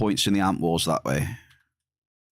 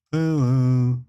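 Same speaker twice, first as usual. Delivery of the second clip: a loud mock in-game hello; loud game greeting; 2 semitones higher, 4 dB louder than usual. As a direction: belt out the hello like the game's sound effect. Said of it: "Are those good sound effects?".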